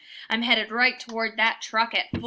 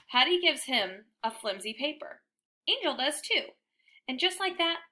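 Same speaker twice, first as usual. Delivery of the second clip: ordinary delivery, nothing marked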